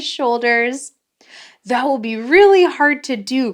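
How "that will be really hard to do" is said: cried. A narrator's reading. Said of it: "cried Michael".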